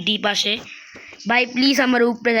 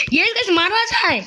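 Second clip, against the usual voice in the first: excited yelling; 6 semitones higher than usual. Real, not acted. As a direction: shout it out mid-fight. Excited yelling while fighting.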